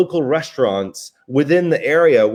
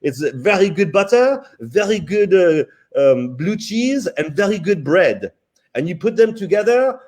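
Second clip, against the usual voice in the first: put-on higher-pitched French-accented English; French chef accent; 6 semitones higher than usual. French chef explains his simple recipe to diner, imitated French accent. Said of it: "he said it in French".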